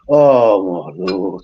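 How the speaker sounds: very slow, low groaned oh my Lord; weary dismay. Retold or imitated. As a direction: drag it out in mock despair. Retold dismay at police news.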